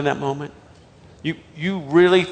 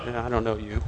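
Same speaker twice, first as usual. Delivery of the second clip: low, soft mock brush-off; dismissive mumble; 8 semitones lower, 13 dB quieter, faster than usual. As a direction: brush him off quietly, half under your breath. Older student brushing off the minister.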